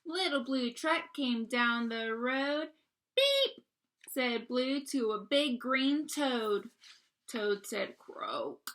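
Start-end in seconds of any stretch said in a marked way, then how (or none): 3.1-3.6 squeaky high toy-horn beep; bright high beep. Acted. Truck horn in read-aloud story. 8.0-8.7 deep soft toad croak; low quiet croak. Acted. Toad voice in read-aloud.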